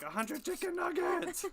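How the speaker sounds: high, slow, amazed cry; excited shout. Retold or imitated. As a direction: squeal it out in disbelief. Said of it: "She's like".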